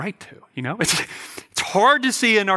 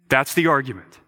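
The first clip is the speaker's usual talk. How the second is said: usual speaking voice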